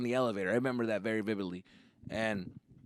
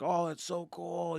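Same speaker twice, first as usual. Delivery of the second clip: drawn-out, higher, soft awe; excited wonder; 6 semitones higher, 5 dB quieter, slower than usual. Retold or imitated. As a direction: marvel like an impressed kid. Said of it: "We were all excited".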